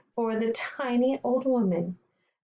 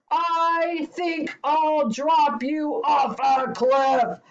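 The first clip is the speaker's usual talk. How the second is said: slow, loud, higher threat; giant's bellow; 6 semitones higher, 5 dB louder, slower than usual. Acted. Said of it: "bellowed the giant".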